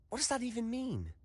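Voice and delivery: high, incredulous question; baffled, voice leaps up; bewildered. Acted. Bewildered by ancient magic.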